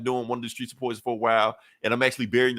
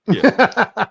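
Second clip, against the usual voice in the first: high, louder drawn-out yeah; unsure fake agreement; 9 semitones higher, 8 dB louder than usual. Captain America bluffing that he is the Punisher.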